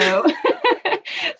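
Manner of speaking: high bursting laugh; bright laugh. Real, not acted. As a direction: break into a laugh. Laughing with guest.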